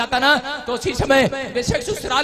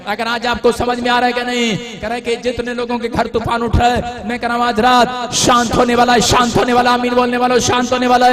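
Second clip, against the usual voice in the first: loud, rising sermon proclamation; fiery pulpit declaration; full-force preaching; 8 dB louder than usual. Acted. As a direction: preach it out to the whole hall. Preacher proclaiming calm to come.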